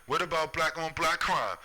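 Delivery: mocking screamer. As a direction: parody the people jeering it. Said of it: "the people screaming".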